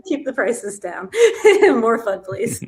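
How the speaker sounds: giggling through the quote; laughing while quoting. Retold or imitated. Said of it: "He said".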